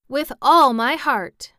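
loud, high, drawn-out enthusiasm; exclaiming. A narrator's reading. Jack accepting the king's friendship, loud exclamation. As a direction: exclaim it wholeheartedly, slow and loud. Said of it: "exclaimed Jack".

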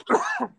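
sudden coughing fit; choking cough. Real, not acted. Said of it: "I'm choking".

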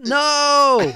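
high, loud mock yell of "No!"; mock shout. Friend acting out the father's furious "No!", mock shout. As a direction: yell "No!" like the movie villain. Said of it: "His response was".

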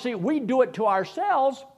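normal talking voice

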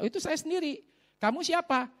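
lightly voiced uncle, high and surprised; a hint of a startled old man at the door. (sedikit suara bapak yang kaget)

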